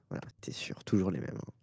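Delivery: soft teasing whisper. Real, not acted. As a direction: whisper it as a private jab. Teasing aside about Ilyes.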